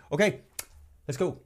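high-pitched wrap-up before leaving; ready to leave. Acted. Someone tired of sitting and talking, ready to leave. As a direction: sound like you are up to go. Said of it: "you want to leave and you go".

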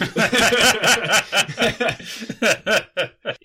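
loud, high burst of laughter; long, high-pitched laughing fit; hearty laugh. Real, not acted. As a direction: crack up loudly at a joke. Joke about slander limits.

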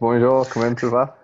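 hammed-up French greeting with an Irish accent; bad French. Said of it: "how bad French sounds in a Mullinavat accent".

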